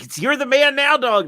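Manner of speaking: high, loud meme catchphrase; meme voice shout. Retold or imitated. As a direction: belt it like the meme. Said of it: "It's like".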